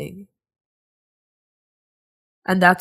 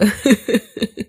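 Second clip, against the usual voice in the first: short laugh; 4 semitones higher, 3 dB louder than usual. Real, not acted. Laughs at own answer.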